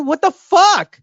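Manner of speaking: high, loud disbelieving what the fuck; shocked outburst. Real, not acted. Host reacts to crowds on the beach, shocked outburst. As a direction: blurt it out in disbelief.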